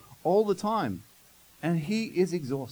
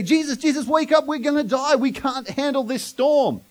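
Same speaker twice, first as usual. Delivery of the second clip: much higher, louder, frantic pleading; panicked cry; 8 semitones higher, 6 dB louder than usual. Retold or imitated. Disciples crying to Jesus in panic, high and loud. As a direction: shout for help as the waves hit. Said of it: "in a moment of panic".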